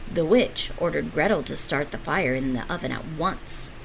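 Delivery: plain reading voice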